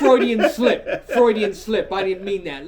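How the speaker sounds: loud, high, laughing cry; real laughing. Real, not acted. Storyteller owning a Freudian slip, laughing loudly. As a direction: blurt the excuse through big laughter.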